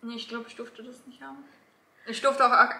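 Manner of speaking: normal talking voice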